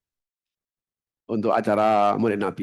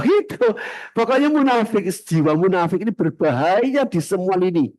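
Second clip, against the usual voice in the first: much higher and louder; fiery preaching; 7 semitones higher, 3 dB louder than usual. Real, not acted. Preacher warning the congregation about hypocrisy, fiery. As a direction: hammer the warning home.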